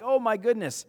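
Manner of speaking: high, loud mock panic; freaking out. Disciple in a storm, freaking out. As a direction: act out a flustered freak-out. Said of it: "wigging out, freaking out".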